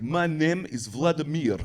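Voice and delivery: slow, mock Russian accent. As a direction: imitate a Russian man introducing himself slowly. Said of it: "you sound like you might be Russian".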